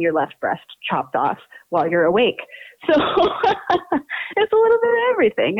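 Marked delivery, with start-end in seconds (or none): 2.9-5.6 laughing. Real, not acted. Laughing while explaining podcast.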